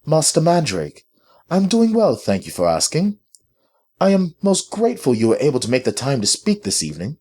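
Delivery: high-pitched, polite British lady; British accent. A narrator's reading. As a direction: speak with authority in a prominent British accent. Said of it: "replied in a prominent British accent".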